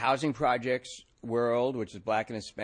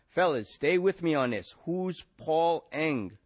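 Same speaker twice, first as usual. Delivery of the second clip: higher, louder, slow on the name; pressing loudly; 6 semitones higher, 3 dB louder, slower than usual. A narrator's reading. Detective pushing the silent men for a name. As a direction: lean on the men to answer.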